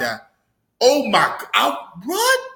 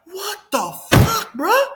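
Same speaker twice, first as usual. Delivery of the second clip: high, drawn-out disbelief; shocked disbelief; 12 semitones higher, slower than usual. Retold or imitated. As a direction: stretch it out high, rising at the end. Retelling shocked reaction to scene.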